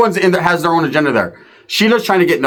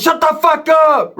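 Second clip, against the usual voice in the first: high, slow snap at the dogs; yelling at dogs; 12 semitones higher, slower than usual. Retold or imitated. Telling the barking pit bulls to shut up.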